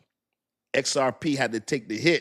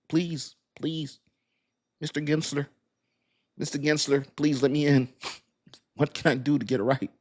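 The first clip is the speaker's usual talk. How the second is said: mock begging; 2 semitones higher than usual. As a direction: beg Mister Gensler, half-joking. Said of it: "beg the S E C for the truth".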